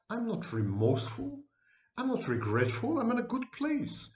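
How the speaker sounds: normal talking voice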